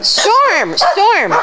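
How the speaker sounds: sharp high call across the room; high call of the dog's name. Real, not acted. Calling the dog.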